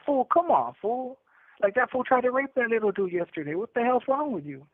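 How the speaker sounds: pitched high, incredulous; arguing with the older cellmate, high and exasperated; exasperated disbelief. Retold or imitated.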